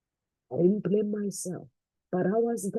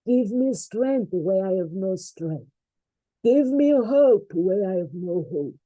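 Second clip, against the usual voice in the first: building prayer. Real, not acted.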